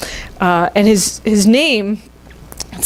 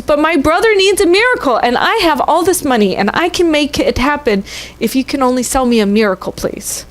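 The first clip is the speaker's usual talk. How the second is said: put-on pleading little girl; 6 semitones higher than usual. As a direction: beg like a small girl for her brother. Girl begs pharmacist for miracle.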